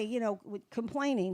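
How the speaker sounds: ordinary talk, nothing marked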